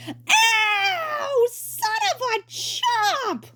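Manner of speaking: high yelp of pain; pained cry. Acted. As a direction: cry out as hair rips. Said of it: "Ludwig cried".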